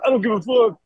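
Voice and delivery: high mock dismissal; dismissive guy. Owner brushes off the name-drop, high mock dismissal. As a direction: toss it off like you don't care. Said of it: "he goes".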